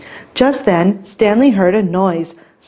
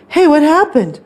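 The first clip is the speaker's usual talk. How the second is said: higher-pitched curious cat; puzzled surprise; 8 semitones higher than usual. A narrator's reading. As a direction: ask with bright storybook surprise. Cats arrive upstairs.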